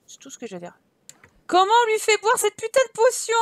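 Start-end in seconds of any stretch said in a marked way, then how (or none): 1.5-3.4 loud, high frustrated outburst; real gamer frustration; exasperated yell. Real, not acted. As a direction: demand it, fed up and loud. Frustrated player, stuck in game.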